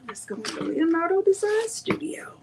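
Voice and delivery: hushed unvoiced whisper. Real, not acted. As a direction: whisper it under your breath. Whispered aside while setting up.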